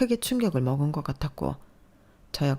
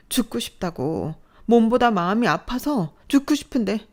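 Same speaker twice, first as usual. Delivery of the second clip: angry outburst; 6 semitones higher, 4 dB louder than usual. Acted. Daughter's tearful outburst continues.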